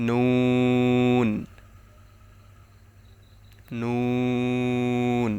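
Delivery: long, steady নুন with tongue tip on palate; slow demonstration of নুন; tongue-tip letter. Shown as a demonstration. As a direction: stretch নুন out into a held n. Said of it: "জিহ্বার আগা তার বরাবর".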